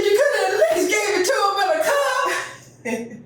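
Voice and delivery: high, loud laughing complaint; exasperated mama. Retold or imitated. Mama scolding daddy over the cone, high and laughing. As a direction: scold him while laughing. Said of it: "She said".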